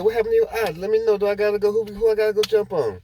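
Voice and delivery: high, loud, fired-up offer to go fight for her friend; protective outrage. Retold or imitated. Another girl offers to fight.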